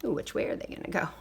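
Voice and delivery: quick whispered aside. Real, not acted. Aside to child listener.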